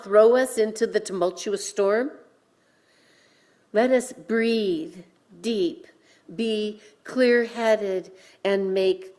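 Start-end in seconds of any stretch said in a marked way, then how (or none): none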